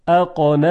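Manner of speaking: اقان with a firm, stopped ق; hard qaf. Shown as a demonstration. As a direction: block then release the ق in اقان. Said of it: "اولی با قاف". (تلفظ اقان با قاف شدید)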